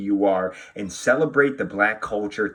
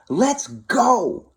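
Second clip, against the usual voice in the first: high, bright let's go; eager call; 12 semitones higher, 3 dB louder than usual. Acted. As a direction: urge the little one off to bed. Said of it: "I say".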